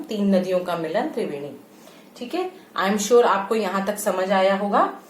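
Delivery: usual speaking voice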